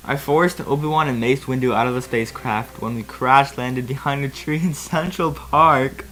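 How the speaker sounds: normal talking voice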